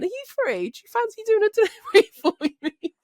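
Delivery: high sing-song favour-asking, breaking into laughter; coaxing plea. Retold or imitated. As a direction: imitate sweetly begging a colleague. Acts out coaxing phone call to driver.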